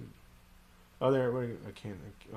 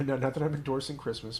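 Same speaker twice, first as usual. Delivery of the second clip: ordinary delivery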